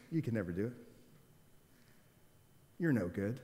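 sigh, then low, soft put-down; defeated self-talk. Acted. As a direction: quietly run yourself down, resigned. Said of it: "your accent is basically".

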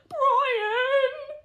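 put-on high, whiny boy's complaint with a crying edge; whining boy. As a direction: whine the name like a sulky child. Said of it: "whined the boy".

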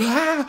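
high excited yelp, reliving the moment; retold shout. Fan recalling the trailer reveal, high excited yelp. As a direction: squeal it out like at the announcement. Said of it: "jeg begyndte bare at råbe".

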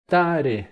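Tare said loud with falling end; tare called out. Shown as a demonstration. Teacher announcing the word Tare loudly. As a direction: call out Tare with a stressed first syllable. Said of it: "O que que é Tare?".

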